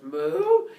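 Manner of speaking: high, blaring moo; loud cow moo. Acted. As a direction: moo loudly in alarm. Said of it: "And the cow goes".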